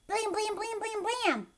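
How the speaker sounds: high punchy knocks; knocking sounds. Acted. Kristen knocking at her parents' door, loud high knocks. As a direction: pound on the door with your voice.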